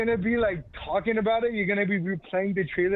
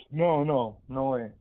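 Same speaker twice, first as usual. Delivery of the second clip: ordinary talk, nothing marked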